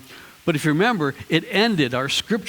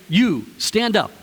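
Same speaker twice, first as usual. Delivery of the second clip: mock command; 4 semitones higher, 3 dB louder than usual. Shown as a demonstration. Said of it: "if I wanted to say".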